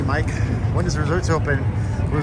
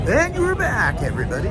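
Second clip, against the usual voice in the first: much higher, booming announcer welcome back; radio announcer voice; 6 semitones higher than usual. Pretend. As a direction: sell it like a radio host coming back from a break. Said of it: "You gotta talk like a radio announcer sometimes".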